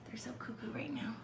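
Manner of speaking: whispered amused aside. Real, not acted. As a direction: breathe it out with no voice. Aside about the dogs.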